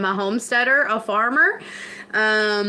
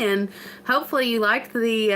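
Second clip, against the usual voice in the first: ordinary talk, nothing marked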